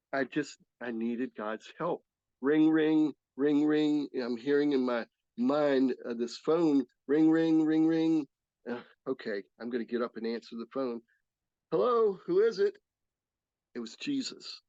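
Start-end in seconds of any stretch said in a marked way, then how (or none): 2.4-4.1 sing-song ring ring, louder; phone ringing. Retold or imitated. Recalling the phone ringing in his mind, mock ring. 7.1-8.3 sing-song ring ring, louder; phone ringing. Retold or imitated. Recalling the phone ringing in his mind, mock ring. 11.7-12.7 high, loud phone greeting; answering the phone. Retold or imitated. Answering a call from Jesus, bright higher hello.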